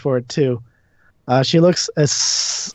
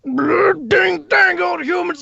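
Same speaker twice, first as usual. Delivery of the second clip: very high, strained cranky voice; high, hammed-up crotchety shouting; riled-up character; slower than usual. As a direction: rant it in a high-pitched holler. Game master voices riled character.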